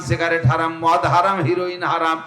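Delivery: usual speaking voice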